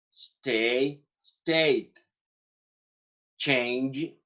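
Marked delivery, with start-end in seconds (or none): 1.2-1.9 stayed closed with a light D; past ending as D. Shown as a demonstration. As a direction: say stayed with a final D, not a T. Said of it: "se van a pronunciar con D al final".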